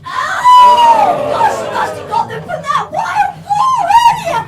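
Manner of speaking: high, loud delighted gush; excited. Acted. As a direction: gush over the ingredient with glee. Delighted gasp at ingredient.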